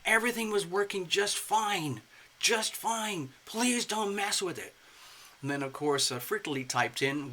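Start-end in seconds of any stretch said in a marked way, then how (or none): 0.0-4.8 higher, exasperated pleading; pleading whine. Retold or imitated. User begging Windows not to upgrade, higher pleading whine. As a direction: beg the software to leave you alone. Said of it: "I kept swearing to myself".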